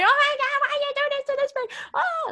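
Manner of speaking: loud, very high, fast pleading wail; loud high sobbing plea of a child; crying child. Retold or imitated. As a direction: wail at them through tears. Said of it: "crying so hard".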